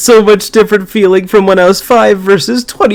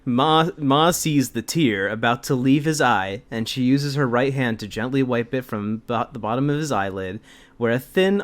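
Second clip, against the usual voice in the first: much softer, hushed mock-tender reading; soft story reading; 9 semitones lower, 13 dB quieter than usual. Reading the mother scene to her co-host, hushed and mock-tender. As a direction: read it like a tender bedtime story. Reads passage softly.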